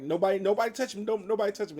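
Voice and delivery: high, loud, fast frantic command; frantic orders. Retold or imitated. Superman warning everyone off, high fast shout. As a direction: bark it out in a rush. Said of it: "and he's like".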